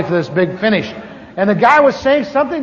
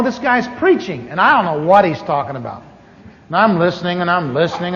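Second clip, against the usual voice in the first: usual speaking voice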